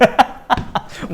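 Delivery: a short airy chuckle; breathy laugh. Real, not acted. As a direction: laugh under your breath. Laughs after the skit.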